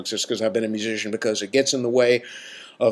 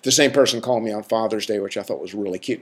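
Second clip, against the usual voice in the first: ordinary talk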